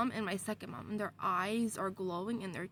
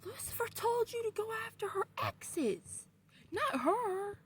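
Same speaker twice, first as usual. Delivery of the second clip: high-pitched scolding, held back in volume; Anthony; 12 semitones higher than usual. Retold or imitated. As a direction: act out an exasperated telling-off up high. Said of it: "I can't do his accent".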